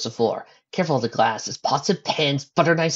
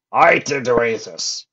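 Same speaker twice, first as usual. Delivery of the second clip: grim, louder denial; 7 dB louder than usual. A narrator's reading. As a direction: deny it grimly. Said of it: "said his brother grimly".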